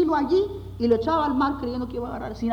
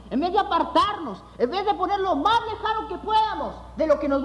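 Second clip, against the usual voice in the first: earnest preaching. Acted. Pastor preaching to his congregation, earnest sermon voice. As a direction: preach it to the hermanos.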